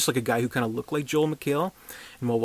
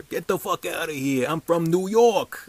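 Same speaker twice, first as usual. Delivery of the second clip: mock New York accent; 5 semitones higher than usual. Reviewer mimics the actor's bad New York accent, hammed-up New York accent. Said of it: "it's not that good of an accent".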